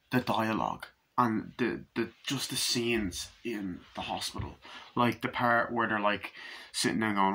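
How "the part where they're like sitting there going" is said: ordinary talk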